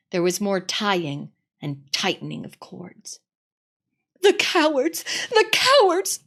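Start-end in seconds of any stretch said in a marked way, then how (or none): 4.2-5.0 higher, tearful cry; sobbing girl. Acted. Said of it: "sobbed Susan". 5.3-6.2 much higher, tearful cry; sobbing girl, rising. Acted. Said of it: "sobbed Susan".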